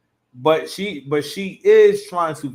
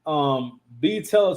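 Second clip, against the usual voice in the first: usual speaking voice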